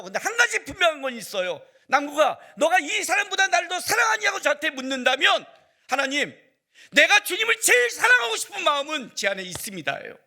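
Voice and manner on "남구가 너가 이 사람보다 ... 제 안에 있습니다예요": much higher, loud impassioned cry; shouted heartfelt confession from the pulpit; fervent shout. Acted. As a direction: proclaim it with full force to God. Preacher cries out his confession.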